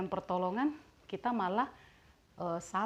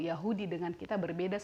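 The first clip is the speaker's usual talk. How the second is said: ordinary talk, nothing marked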